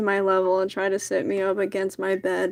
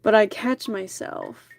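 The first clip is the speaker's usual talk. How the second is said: plain reading voice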